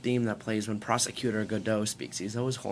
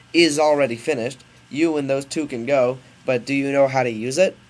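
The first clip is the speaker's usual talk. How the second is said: loud, raised hammy professor; scientist character; 5 semitones higher, 10 dB louder than usual. Acted. Scientist shows his son the ship, loud and hammy. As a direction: project like an inventor showing off. Scientist figure voiced in story.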